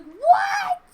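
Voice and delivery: very high, loud squawk of surprise; shocked what. Acted. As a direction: shriek it in total disbelief. Ethan shocked at rejection.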